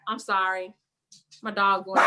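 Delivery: ordinary talk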